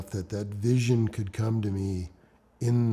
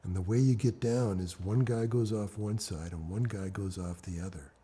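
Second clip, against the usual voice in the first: ordinary talk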